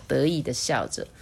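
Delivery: ordinary delivery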